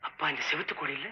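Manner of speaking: very low, slow, hushed horror narration; spooky storytelling. Acted. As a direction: draw the listener into dread. (திகில் கதை சொல்லும் குரல்)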